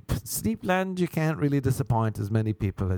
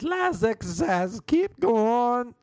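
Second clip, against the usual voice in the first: donkey testing a microphone; 12 semitones higher, much slower than usual. Retold or imitated. Yoga teacher on her headset to the class, donkey-like high bray. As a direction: bray it like a donkey checking a mic. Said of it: "Like a donkey testing a microphone".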